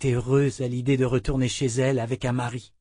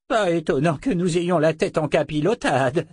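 high-pitched indignant squeak; squealing; 6 semitones higher, 3 dB louder than usual. A narrator's reading. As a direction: squeak it out in protest. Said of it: "couina Ruben". (couinement aigu et indigné)

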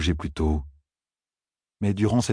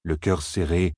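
plain reading voice

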